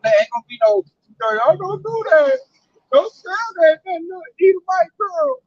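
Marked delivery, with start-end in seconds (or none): 1.4-5.4 high, slow, whiny mock plea with a fake cry; mock pleading. Mocking a begging Edomite, high whiny fake crying. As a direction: play a begging captive, hammed-up.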